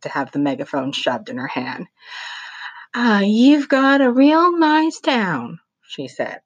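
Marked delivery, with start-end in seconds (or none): 2.9-5.6 higher, slow folksy lady's voice; syrupy spokeswoman charm; Mother Paula. A narrator's reading. Mother Paula greeting the crowd, higher and slow.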